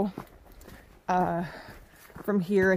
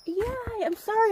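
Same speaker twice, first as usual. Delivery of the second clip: high apologetic voice; 12 semitones higher than usual. Real, not acted. Apologising to the grouse.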